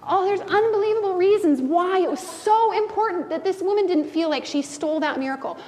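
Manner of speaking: ordinary talk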